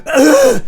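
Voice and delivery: high loud creepy groan; a loud leering grunt. Acted. Streamer ending the creepy bit, loud high grunt. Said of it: "this is how to creep out people".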